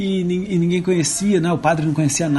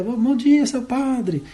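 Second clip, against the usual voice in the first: little bird greeting, a bit higher; the thrush's voice; 6 semitones higher, slower than usual. Acted. Priest voicing the thrush to the boy, higher. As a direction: chirp it like a friendly bird. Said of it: "E aí respondia".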